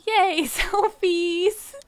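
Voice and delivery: excited. Real, not acted. Excited about selfies.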